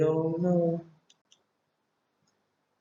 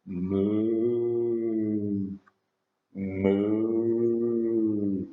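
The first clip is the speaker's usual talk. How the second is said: lower, sing-song mooing like a cow; 4 semitones lower than usual. Shown as a demonstration. Teacher answering what the cow says, sing-song moo. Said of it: "What's the cow say?".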